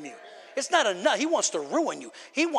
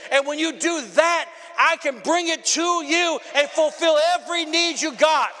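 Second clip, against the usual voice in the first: voicing God; 8 semitones higher, 6 dB louder than usual. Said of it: "He said".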